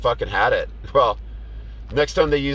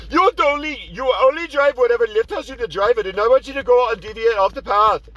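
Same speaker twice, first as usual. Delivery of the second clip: much higher, nagging imitation of the passenger; mocking passenger voice; 11 semitones higher than usual. Passenger scolding the driver about the route, high mocking voice. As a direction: whine it like a bossy rider. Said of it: "He's like".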